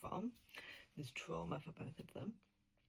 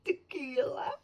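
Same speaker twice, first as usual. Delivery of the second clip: Tequila called out loud with a falling end; calling the title proudly; excited blurt; 8 semitones higher, 11 dB louder, slower than usual. Real, not acted. Author recalling the book title to viewers, loud. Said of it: "Blame It on".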